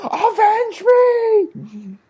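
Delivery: high, slow, wailing dying cry; overacted death scene. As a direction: wail it out like a dying hero.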